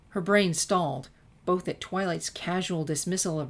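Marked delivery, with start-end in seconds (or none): none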